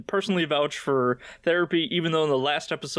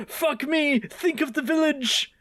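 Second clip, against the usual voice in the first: mock frantic plea; 12 semitones higher than usual. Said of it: "He's like".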